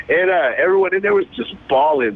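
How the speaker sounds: usual speaking voice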